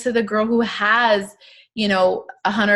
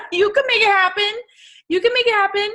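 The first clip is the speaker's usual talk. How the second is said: high excited cheer; 12 semitones higher, 3 dB louder, faster than usual. Real, not acted. Coach cheering her team in a high excited squeal.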